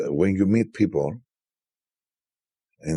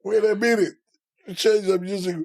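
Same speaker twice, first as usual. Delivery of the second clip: high, sudden surprised exclamation; mock startled cry; startled what; 10 semitones higher than usual. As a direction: react as if caught off guard. Startled reaction to screaming fans.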